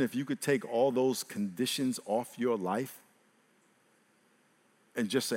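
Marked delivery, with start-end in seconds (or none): none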